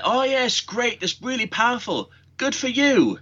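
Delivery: high-pitched sarcastic cheer; mock enthusiasm. Sufferer scoffing at a supposed hay fever remedy, mock enthusiasm. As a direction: gush in a high, mocking voice. Mocking the nettle remedy's promise.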